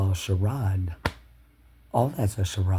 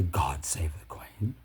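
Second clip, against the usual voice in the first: low unvoiced whisper, slowed; slow reverent whisper; 6 semitones lower, 9 dB quieter than usual. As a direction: breathe the motto in a hush. Hushed quoted motto.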